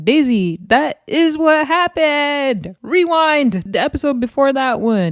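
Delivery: high-pitched, emphatic correction; insistent retort. Retold or imitated. Host argues back at Daisy about what happened, insistent. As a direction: set her straight, pitched well up.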